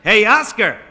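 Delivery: loud, high holler; big exasperated yell. Acted. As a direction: call up angrily to someone on a roof. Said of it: "the winded fireman yelled".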